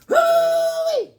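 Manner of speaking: a very high, loud shouted whoop; cowboy whoop. Acted. Fan whooping for Billy the Kid, high and loud. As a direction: let out a wild western yell.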